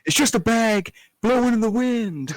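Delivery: loud, high, drawn-out booming proclamation; exasperated God. Acted. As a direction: holler it down from the heavens, slowly. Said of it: "God comes down and he's like".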